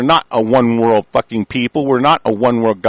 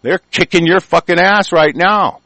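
angry shout; 7 semitones higher, 6 dB louder than usual. Real, not acted. Ranting at corrupt powers.

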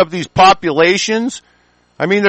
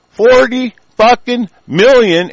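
Forty fucking million dragged out slow and higher; slow angry emphasis; 6 semitones higher, 3 dB louder, slower than usual. Real, not acted. Ranting at his viewers about millions ready to fight, slow and angry. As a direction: lean hard on every word.

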